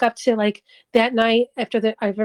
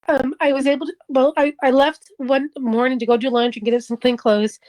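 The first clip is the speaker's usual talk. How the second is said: usual speaking voice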